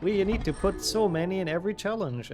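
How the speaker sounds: lightly voiced eager designers; imitating game designers. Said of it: "they were like".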